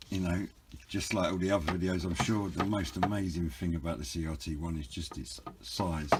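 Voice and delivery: ordinary talk, nothing marked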